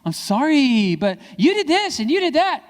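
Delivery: loud, high mock whine; hammed-up defensive kid; blame-shifting apology. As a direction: turn a sorry into accusation. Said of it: "don't shift blame".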